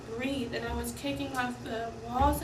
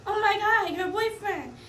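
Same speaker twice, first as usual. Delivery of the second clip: exasperated retort; 8 semitones higher, 6 dB louder than usual. Retold or imitated. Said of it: "exasperated retort".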